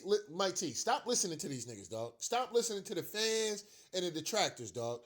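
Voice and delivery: ordinary delivery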